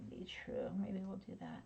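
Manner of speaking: soft muttered whisper. Real, not acted. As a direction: murmur it to yourself. Muttering to herself while working.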